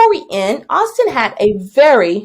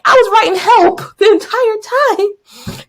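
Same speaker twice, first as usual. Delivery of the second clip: high-pitched indignant protest; hammed-up exasperation, stretching the last words; retold exasperation; 10 semitones higher than usual. Freed teacher explaining the paper towels, exasperated protest.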